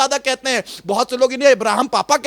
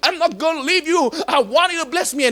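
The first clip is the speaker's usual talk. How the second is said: a touch of dogged insistence, drawn out; stubborn insistence; 3 semitones higher, much slower than usual. Acted. Preacher acting Jacob wrestling with God, stubbornly insistent. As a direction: plead stubbornly, refusing to budge. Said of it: "fought God like a stubborn man".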